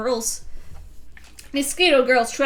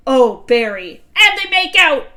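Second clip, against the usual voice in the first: scandalised shout; 4 semitones higher, 11 dB louder than usual. Acted. Scandalised parent voiced.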